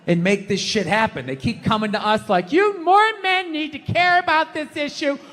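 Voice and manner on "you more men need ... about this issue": high, pleading activist voice; mocking feminists lecturing men, hammed-up; mock preachy plea. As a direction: nag it like a self-righteous campaigner.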